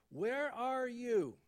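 God calling Adam among the trees. Retold or imitated. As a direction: call it out to someone hidden. God calls to hiding Adam.

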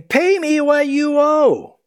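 loud, high, slow demand for payment; harsh demand. Acted. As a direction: bark it out like a creditor grabbing a throat. Servant demands his debt.

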